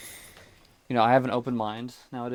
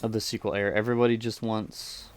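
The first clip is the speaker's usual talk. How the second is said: usual speaking voice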